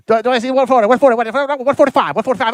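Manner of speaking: fast, high auctioneer patter. Shown as a demonstration. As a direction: rattle off bids like an auctioneer. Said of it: "auctioneer voice".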